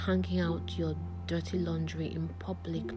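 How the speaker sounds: normal talking voice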